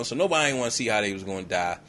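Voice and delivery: ordinary talk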